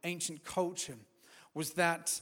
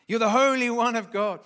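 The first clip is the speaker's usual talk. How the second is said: demons crying out; 5 semitones higher, 13 dB louder than usual. Acted.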